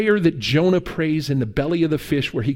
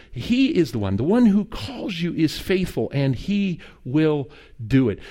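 ordinary talk, nothing marked

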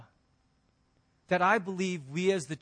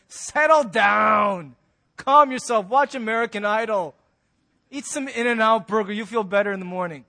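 soothing mock; 3 semitones higher, 7 dB louder than usual. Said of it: "Everyone's like".

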